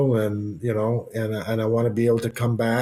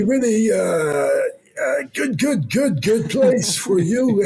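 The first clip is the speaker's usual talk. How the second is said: a chummy tip-off pitched way up; imitating René Angelil; 10 semitones higher than usual. René Angelil pushing Colorado on Ray, much higher impression voice. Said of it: "You sound just like him".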